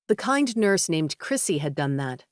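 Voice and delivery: usual speaking voice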